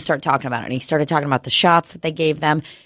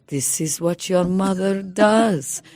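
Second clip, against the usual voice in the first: slow, drawn-out dad impression; lightly voiced dad, each word spaced out; imitating dad; 2 semitones higher, 4 dB quieter, slower than usual. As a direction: put on dad's slow delivery. Dad quoted, laughing retelling.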